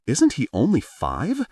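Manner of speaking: can't believe it; aunt astonished at a five-year-old training. A narrator's reading. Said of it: "Hiyori said in astonishment".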